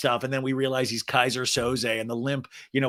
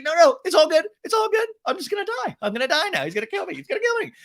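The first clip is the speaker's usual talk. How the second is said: mock terror; 4 dB louder, much faster than usual. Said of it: "She's like".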